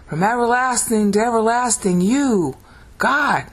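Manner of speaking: high, ringing declamation; dramatic proclamation. Shown as a demonstration. Teacher giving the verse in English, dramatic. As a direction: proclaim it with a pause before the last word. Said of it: "It's dramatic".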